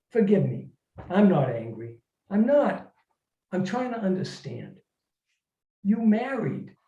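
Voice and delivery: ordinary talk, nothing marked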